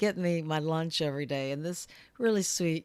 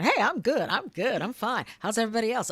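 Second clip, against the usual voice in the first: upbeat bouncy; 7 semitones higher than usual. Retold or imitated. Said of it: "bouncing around going".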